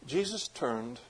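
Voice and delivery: normal talking voice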